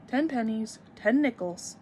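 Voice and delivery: plain reading voice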